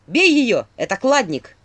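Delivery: loud, high urgent order; shouting. A narrator's reading. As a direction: cry out a sudden command, loud. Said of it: "закричал Филин". (громкий крик-приказ)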